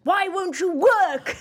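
high, loud, slow mock wail; hammed-up despairing cry, drawn out and high; Inbetweeners impression. Podcaster quotes a sitcom over sexual failure, mock-desperate wail. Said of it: "That's like on The Inbetweeners".

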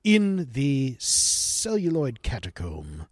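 slow, grand induction announcement; mock-solemn master of ceremonies, drawn out; ceremonial announcer.

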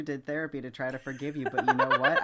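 giggling as she speaks, voice jumping up; laughing through it. Retold or imitated. Imagining telling old Harry off, laughing through the words. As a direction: break into laughter mid-rant.

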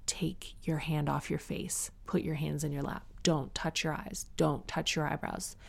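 gentle whispered self-talk; self-calming. Retold or imitated. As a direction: hush yourself into stopping. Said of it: "I had to take a moment and go".

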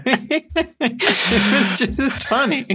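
high, slow, giggling words; can barely get it out for laughing. Real, not acted. Giggle fit while apologising.

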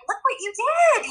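high, slow praise; acknowledging child. Retold or imitated. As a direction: affirm a child's effort gently. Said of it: "but just like".

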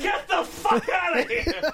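put-on high, slow shout at the kids; drawn-out yell. Drunk friend to children, drawn-out yell. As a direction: stretch it out as a yell.

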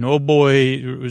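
usual speaking voice

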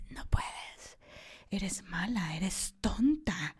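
hushed inner critic whisper. Retold or imitated.

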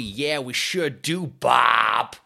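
second crewman's hammy answer; mock North American crew. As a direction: drawl it like a folksy crewman. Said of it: "they were just chatting, like".

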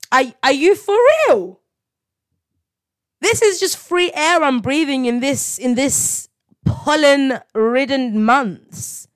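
amazed delight. Real, not acted. Amazed at breathing freely.

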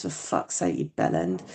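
voiceless curse at herself; self-scolding mutter. Real, not acted. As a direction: hiss it at yourself, barely audible.

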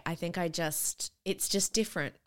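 ordinary talk

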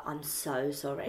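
soft, low, slowed-down retold apology; mortified apology. Bartender apologising to a misjudged customer, quietly mortified. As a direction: shrink into a quiet, embarrassed sorry.

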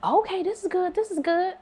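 imitating him in a high-pitched voice; pleased approval. Common tastes the wine she picked, high pleased approval. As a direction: lift it well up, an approving taster.

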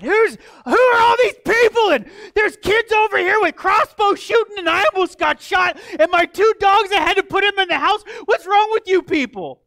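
high, loud outraged rant; a hammed-up furious old lady; angry neighbor. Pastor imitating the neighbor yelling at him, high and loud. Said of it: "she just laid into me".

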